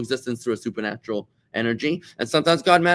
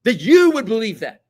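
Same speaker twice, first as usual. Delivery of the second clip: indignant, much higher and louder voice; outraged friend mid-argument, pitched up and loud; 11 semitones higher, 9 dB louder, slower than usual. Retold or imitated. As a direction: play the offended debater losing it. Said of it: "and it's like".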